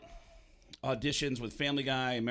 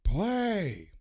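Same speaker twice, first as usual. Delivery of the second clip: louder, mocking Pacino, last line slow; Al Pacino impression; 6 semitones higher, 3 dB louder, slower than usual. Pacino mocking Baldwin's play, in a Pacino impression. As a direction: sneer at the fruity little play. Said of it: "And he goes".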